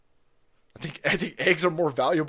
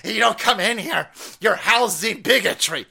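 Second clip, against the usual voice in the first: mock accuser; 4 semitones higher, 5 dB louder than usual. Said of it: "I'm like".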